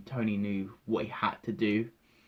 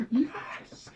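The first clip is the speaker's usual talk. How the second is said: high breathy squealed yes; laughing high squeal. Retold or imitated. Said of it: "I was like".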